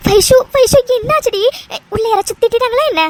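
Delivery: urgent, worried questioning; alarmed concern. Acted. Friend asking who scolded weeping Vaishu, alarmed. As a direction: press a crying friend anxiously.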